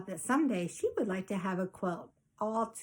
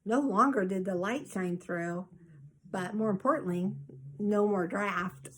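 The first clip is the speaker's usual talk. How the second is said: usual speaking voice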